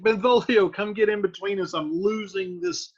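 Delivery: high, loud call to a friend; mock plea for help. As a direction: beckon for backup, half in jest. Paraphrases Mercutio calling for help.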